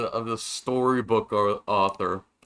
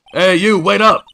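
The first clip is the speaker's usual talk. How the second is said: high, loud call after someone; 9 semitones higher, 10 dB louder than usual. Acted. Stranger calling after the pair, loud call. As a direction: shout for them to wait up.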